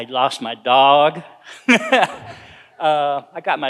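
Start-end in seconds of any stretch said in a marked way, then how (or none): 1.5-2.3 a short burst of laughter, pitched up; quick high laugh. Real, not acted. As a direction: laugh briefly at the irony. Laugh after lost dog.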